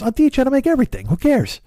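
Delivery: high, rushed neighbor voice; Lou impression. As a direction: brush it off fast and high, imitating. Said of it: "your ears are bleeding from my Lou".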